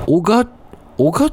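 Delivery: real hesitation over the place name "おが-"; unsure pronunciation. Real, not acted. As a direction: stumble on "おが-" and stop short. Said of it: "発音がわかんない".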